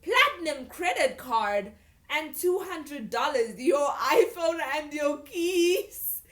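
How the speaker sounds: loud, high, slow and drawn out; hammed-up gushing over riches; over-the-top announcing. Reader mocking the lavish wallet contents, loudly hammed-up.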